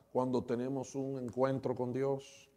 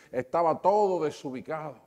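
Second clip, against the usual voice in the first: ordinary talk, nothing marked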